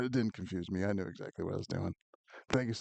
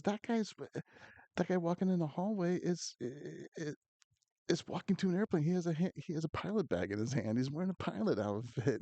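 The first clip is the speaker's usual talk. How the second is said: imitated dad, higher-pitched and insistent; retold fatherly protest in a raised pitch; incredulous dad; 8 semitones higher than usual. Dad picking apart his son's pamphlet, incredulous. Dad's exasperated argument over pamphlet.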